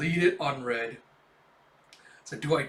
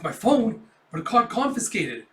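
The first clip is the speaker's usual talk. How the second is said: surprised; 5 semitones higher, 5 dB louder than usual. Retold or imitated. Kafka surprised at getting phone.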